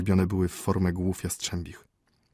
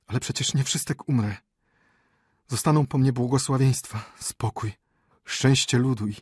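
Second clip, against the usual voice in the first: dying consolation; 5 semitones higher than usual. Acted. Dying prince consoles himself.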